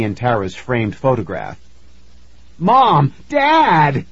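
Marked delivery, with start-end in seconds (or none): 2.6-4.0 high, loud cry out to parents; joyful scream. A narrator's reading. Nicky calls to long-lost parents, screaming. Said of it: "Nicky screamed".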